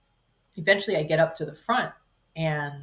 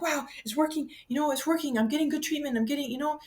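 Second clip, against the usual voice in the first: excited inner voice; 7 semitones higher, 4 dB quieter than usual. Retold or imitated. Inner thrill retold, raised pitch.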